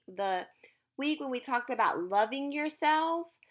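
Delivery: usual speaking voice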